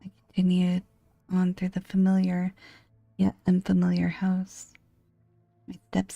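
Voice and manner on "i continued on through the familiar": ordinary delivery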